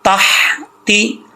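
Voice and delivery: तहती with a long rasped breath of हा from the throat; slow तहती, let the throaty h flow. Shown as a demonstration. Teacher says this हा comes from throat, slow recitation. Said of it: "इस हा का ताल्लुक हल्क से है".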